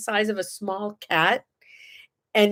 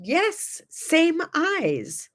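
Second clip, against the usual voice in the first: higher imitated Chinese accent; 8 semitones higher, much slower than usual. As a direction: answer brightly in a put-on Chinese accent, higher. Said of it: "in her very thick Chinese accent".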